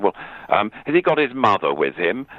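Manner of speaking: a hint of a fussy man off stage; lightly voiced distant man; mock John voice. John answers from background.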